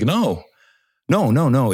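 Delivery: high, rising repeated no's; dismayed denial. Retold or imitated. Pilot realizing the tree hit the rotors, high dismayed no's. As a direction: pile up the no's in disbelief.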